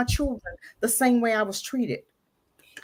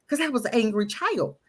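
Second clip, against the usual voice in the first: normal talking voice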